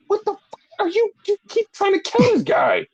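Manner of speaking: high-pitched, disbelieving protest; mock incredulity. Acting out the reaction to Brian Cage's beating, incredulous.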